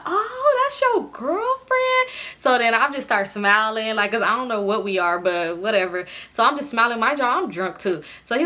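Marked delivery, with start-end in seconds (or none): 0.1-2.1 high, slow, drawn-out surprise; mock amazed at the news; mock surprised. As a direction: guys teasingly surprised at the girlfriend claim, high and stretched.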